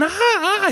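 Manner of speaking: high-pitched mock scream; two hammed-up squeals, way up high; imitated screaming. Host retelling the two women turning round, mock scream. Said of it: "y siguen gritando".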